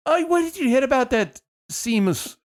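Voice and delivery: high-pitched mock Scottish couple; hammed-up, badly faked Scottish; bad Scottish accent. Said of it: "Can't do Scottish".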